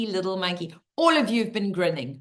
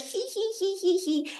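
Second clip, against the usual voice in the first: cheeky monkey giggle; 10 semitones higher than usual. Acted. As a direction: giggle squeakily like a mischievous little monkey.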